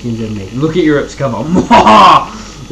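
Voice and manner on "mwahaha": loud, slow evil cackle; sneering panto villain. Pretend. Gloating over [name]'s cover art.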